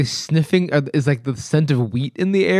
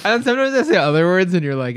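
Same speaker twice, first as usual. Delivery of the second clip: ordinary talk, nothing marked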